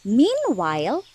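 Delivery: ordinary talk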